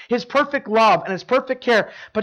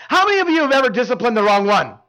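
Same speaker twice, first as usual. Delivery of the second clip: louder, teasing punchline question; joking punchline; 4 semitones higher, 5 dB louder, faster than usual. Retold or imitated. Pastor joking to the dads in church, teasing and louder. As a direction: land the joke on the dads with a grin. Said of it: "then I'll joke".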